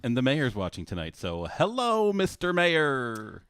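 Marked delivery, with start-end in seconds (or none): 1.5-3.4 high, drawn-out mock hail; playful singsong call to an official; calling out greeting. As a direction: stretch the greeting out grandly, way up high. Calling out to watching mayor.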